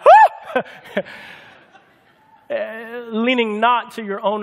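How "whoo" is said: high whooping laugh, much louder; barber's laughing whoop. Retold or imitated. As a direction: burst out in a laughing whoo. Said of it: "my barber was like".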